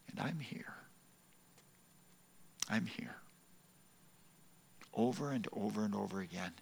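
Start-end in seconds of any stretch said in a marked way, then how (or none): 0.1-3.1 soft, tender whisper of comfort; gentle reassurance. Retold or imitated. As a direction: soothe him softly at a bedside. Nurse's voice soothing injured man.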